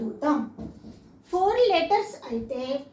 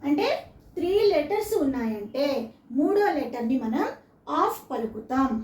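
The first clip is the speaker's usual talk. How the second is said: ordinary talk, nothing marked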